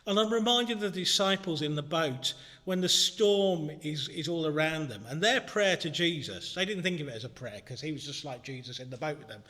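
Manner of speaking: normal talking voice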